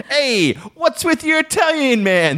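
high, hammed-up complaint; mock indignant customer. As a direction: accuse the clerk in a squeaky voice. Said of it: "I'll be like".

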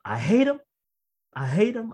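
high-pitched retold outburst; blurted outburst. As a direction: replay the helpless blurting, pitch jumping up. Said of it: "it just came out that way".

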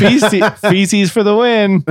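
loud, high-pitched celebration; excited cheer. Real, not acted. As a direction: cheer it out like a win. Cheering the surprise winning answer.

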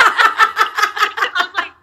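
words squeezed out through laughter; laughing. Real, not acted. Laughing through the retelling to friends. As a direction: keep laughing as you speak, drawn out.

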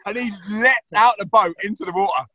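ordinary delivery

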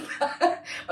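a short breathy embarrassed laugh. Real, not acted. Speaker laughing at her own embarrassment, breathy giggle. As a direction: laugh it off shyly.

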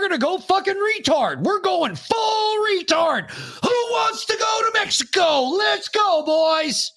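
high shouted party-bro rally cry; frat bro. Pretend. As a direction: yell it like a pumped-up frat guy. Hyped rallying cry to the boys.

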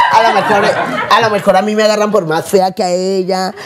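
giggling through the words; laughing. Real, not acted. Guest jokes she is hired for being uglier, laughing. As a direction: laugh while you joke about yourself.

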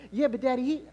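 protesting little daughter. Acted. Daughter objecting to her father, childlike protest. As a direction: plead like a small kid. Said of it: "I told her".

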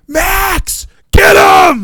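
high, loud urging scream at the TV; yelling. Retold or imitated. Two fans urging on a favorite player, yelling. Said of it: "We were both yelling".

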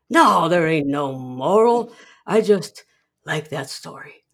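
drawn-out loud "No", then easing off; mock crotchety old man waving off the question; Burgess Meredith. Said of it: "Burgess Meredith replies".

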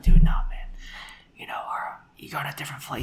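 hushed unvoiced whisper. Shown as a demonstration. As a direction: whisper it like you should have. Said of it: "He wasn't whispering".

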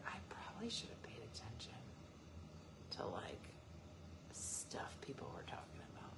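whisper. Retold or imitated. Tipsy Tuesday regret voice.

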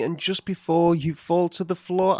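ordinary delivery

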